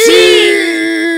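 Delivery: loud yes. Acted. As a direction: roar the yes. Grito de acuerdo. (grito fuerte y agudo)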